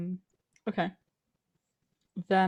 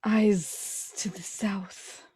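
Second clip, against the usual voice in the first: hushed urgent hiss; hissed whisper; slower than usual. Acted. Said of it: "I will kind of hiss up at him".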